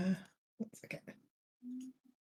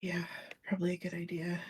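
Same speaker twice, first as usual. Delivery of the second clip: whispering; 12 dB quieter than usual. Acted. Quiet sneaking scene.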